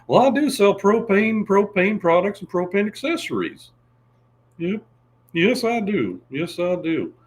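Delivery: high, loud Texan salesman; proud propane pitch, high and loud; Hank Hill impression. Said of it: "Don said hey that's good".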